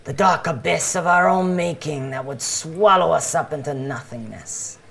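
fervent doomsday preaching, voice lifted; street preacher. Acted. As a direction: proclaim doom and salvation to passers-by. Street preacher's repeated spiel.

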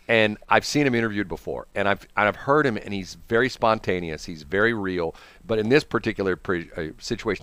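normal talking voice

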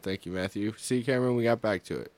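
ordinary delivery

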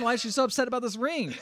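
high, fast, baffled outburst; exasperated outburst. Retold or imitated. Recapping the ring scene to a co-host, high exasperated cry. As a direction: rush it out in mock exasperation. Acts own exasperated reaction to film.

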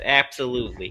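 usual speaking voice

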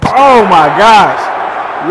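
loud, long drawn-out exclamation; stretched-out cry of disbelief; thrilled shout. Real, not acted. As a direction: shout it slowly in amazement. Reacting to winning the championship.

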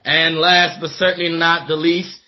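ordinary talk

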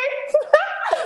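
high burst of laughter; real laugh breaking out. Real, not acted. Laughing at own retold outburst.

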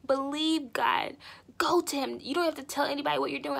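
ordinary talk

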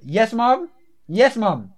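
loud, forceful answer to mom; with some bass. Real, not acted. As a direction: call back firmly to your mother. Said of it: "you said that with a bit of bass".

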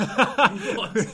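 a sudden loud, high, laughing "what?"; cracking up in disbelief. Real, not acted. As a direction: blurt it out in amused shock. Host startled by climbing detail.

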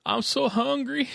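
high, slow wailed imitation; hammed-up blubbering, words stretched out; mock crying. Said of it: "He's on the phone crying".

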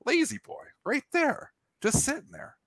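high, delighted exclamation at spotting the chair; delighted discovery. Retold or imitated. Drunk friend spots a recliner to sleep in, delighted and high. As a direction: squeal it out like finding treasure. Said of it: "He says, "Oh".

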